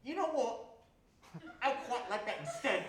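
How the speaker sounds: high, loud caricature voice; cartoonish high-pitched bumpkin; two-toothed yokel. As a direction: play a hammed-up toothless yokel.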